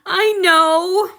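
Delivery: high, loud sob through the words; sobbing. Acted. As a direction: break down in relieved tears. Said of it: "Thaddeus sobbed".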